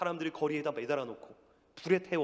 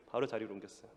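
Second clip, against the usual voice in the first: ordinary talk, nothing marked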